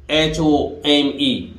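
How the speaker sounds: slow letter-by-letter spelling; spelled out slowly. Shown as a demonstration. Teacher spelling a word slowly for students.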